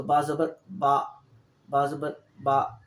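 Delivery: slow, clear बा ज़बर बा with a short final a; short zabar demo. Shown as a demonstration. Teacher to students, showing the plain zabar sound slowly. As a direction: spell out बा ज़बर बा slowly, the last बा kept short. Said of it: "बा के ऊपर अगर ज़बर हो".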